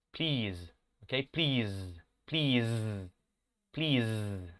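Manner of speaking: Please repeated slowly, final z buzzed long; buzzing final z. Shown as a demonstration. Teacher drills Please, stretching the buzzing z. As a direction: drill Please with a vibrating z at the end. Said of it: "traten de hacer el sonido de la vibración".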